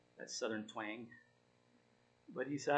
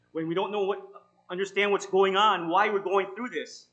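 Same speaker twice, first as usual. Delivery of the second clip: louder, urging preacher's voice; emphatic preaching; 5 semitones higher, 11 dB louder than usual. Acted. Pastor urging the struggling listeners, louder and emphatic. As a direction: press the promise on them.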